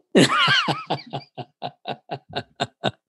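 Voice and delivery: a big real burst of high laughter; hearty laugh. Real, not acted. Telling how he got a week off, real laugh.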